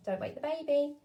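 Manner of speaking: put-on "Don't wake the baby" called out; mock-alarm warning. Shown as a demonstration. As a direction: set up a game. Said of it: "Can you do it with your finger on your lips?".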